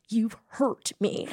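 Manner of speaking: slow, soft, wounded mock American accent; imitated American accent. Wife to husband in a therapy scene, imitated American accent. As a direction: softly act hurt in a put-on American accent. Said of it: "it's the R's".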